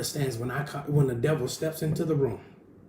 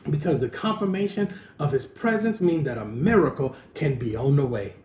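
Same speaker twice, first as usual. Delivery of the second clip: loud, raised preaching voice, slowing on the opening; full-force preaching; 3 semitones higher, 5 dB louder than usual. Acted. Preacher to congregation about God's power, loud fervent preaching. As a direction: drive it home from the pulpit.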